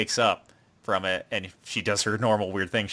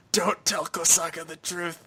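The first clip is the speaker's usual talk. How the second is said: lightly voiced dying girl; 6 semitones higher than usual. Dying Rina.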